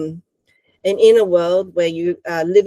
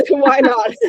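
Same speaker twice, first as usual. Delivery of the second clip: laughing; 7 semitones higher, 3 dB louder than usual. Real, not acted. Laughing along with her guest.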